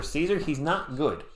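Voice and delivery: ordinary talk, nothing marked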